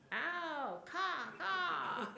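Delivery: loud, high squawking caws; crow caw. Acted.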